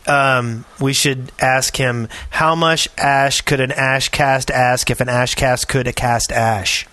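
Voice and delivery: normal talking voice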